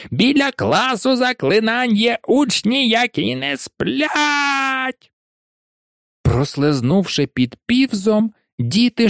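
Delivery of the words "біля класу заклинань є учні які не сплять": shrill high screech, much higher; shrieking. A narrator's reading. Peeves shrieking to alert Filch about the pupils. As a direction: screech it to the whole castle. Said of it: "заверещав Півз".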